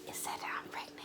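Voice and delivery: very soft whispered confession; whispering. Retold or imitated. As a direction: whisper it, almost inaudible. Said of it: "I was just whispering".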